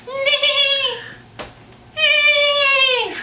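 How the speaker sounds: high loud playful neigh; whinny it loud and high; horse neigh. Pretend. Mom voices horse for toddler.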